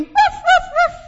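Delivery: high, loud barks; sharp mimicked woofs; imitated dog barking. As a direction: bark like an alarmed dog. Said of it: "the dog started barking".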